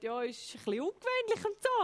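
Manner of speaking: high-pitched, imitated doubtful pastor; mock hesitation. Pastor at the baptism talk weighing an unusual request, hesitant. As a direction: voice a pastor's reservations, pitched well up.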